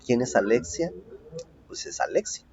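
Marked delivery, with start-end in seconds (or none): 1.7-2.4 whispered playful aside; joking whisper. Real, not acted. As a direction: whisper it as a joke. (susurro en broma)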